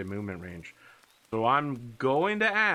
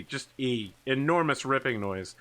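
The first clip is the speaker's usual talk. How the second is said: normal talking voice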